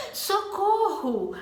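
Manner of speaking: a slow alarmed plea; with intonation. Shown as a demonstration. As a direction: call for help in alarm. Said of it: "Agora, se eu falo".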